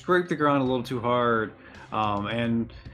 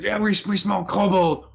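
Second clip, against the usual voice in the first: high, loud dopey troll; 7 semitones higher, 4 dB louder than usual. Acted. Troll tells the ogre, dopey high loud voice. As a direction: say it like a pleased dim troll.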